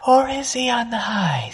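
high, airy whisper; breathy whisper. Shown as a demonstration. Teacher demonstrating a breathy high voice. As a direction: let the breath carry it up high. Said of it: "of being breathy".

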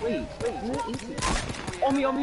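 high, loud panicked gamer; frantic, getting shot at; panicking gamer. Real, not acted. Teammate under fire in game.

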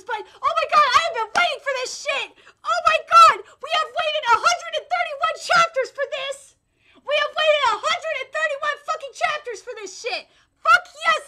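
high, loud fan outburst; elated ranting. Real, not acted. Fan who waited all those chapters, elated shouting. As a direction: shout it in overjoyed disbelief.

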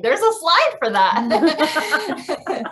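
high, loud and slow through laughter; laughing. Real, not acted. Presenter to fellow teachers, cracking up at her own slide. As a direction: laugh out the punchline.

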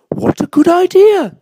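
high, loud enthusiastic voice; high-pitched bird character; owl character. A narrator's reading. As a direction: agree keenly as an owl. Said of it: "said the owl".

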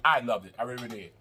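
usual speaking voice

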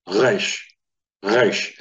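"Reish" with R formed where G sits, back of the throat; throaty R in "Reish" from the G position; guttural R. Shown as a demonstration. Teacher drilling the guttural R for students. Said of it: "try forming the sound for R".

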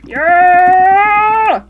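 loud triumphant shout; excited yell. Real, not acted. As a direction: cheer the catch. Catching a fish.

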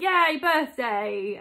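slow, loud mock cheer; imitating your own birthday excitement; retold cheer. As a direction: stretch out a celebratory yay. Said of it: "And then I was like".

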